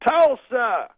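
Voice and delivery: high, loud stage shout; Bill Cosby. Retold or imitated. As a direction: imitate a comedian calling out to the crowd. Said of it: "Then he goes".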